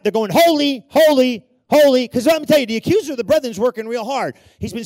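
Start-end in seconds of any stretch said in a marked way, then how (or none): 0.3-2.1 three loud, high bursts; freaking-out angels. Acted. Angels freaking out over perpetual mercy, crying out loudly. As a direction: shout each one as an amazed acclamation. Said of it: "angels are freaking out".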